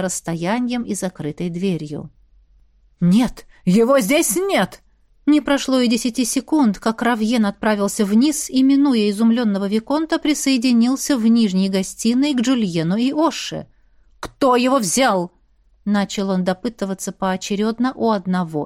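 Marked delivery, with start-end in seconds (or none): 3.0-4.8 loud distant exclamation; громкое восклицание. Acted. Равьен кричит наверху. 14.2-15.3 slow, loud angry question; angry demand. Acted. Равьен допрашивает слуг.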